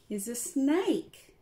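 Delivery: plain reading voice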